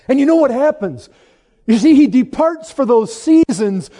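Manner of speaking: high, loud, forceful sermon delivery; impassioned preaching. Real, not acted. Preacher explains why Christ departs for seasons, impassioned preaching. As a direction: press it on the congregation with full conviction.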